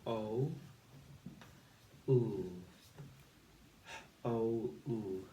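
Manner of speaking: o and oo said as separate sounds, twice; segmented phonics sounds; sounding out phonics. Shown as a demonstration. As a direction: sound out the parts of whole. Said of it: "So we've got".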